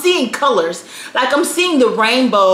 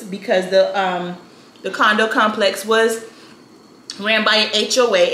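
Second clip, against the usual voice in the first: ordinary talk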